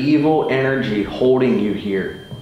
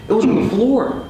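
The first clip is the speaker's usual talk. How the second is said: much higher, urgent pointing out; excited; 11 semitones higher, 3 dB louder, faster than usual. Real, not acted. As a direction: blurt it out, spooked. Startled by something on camera.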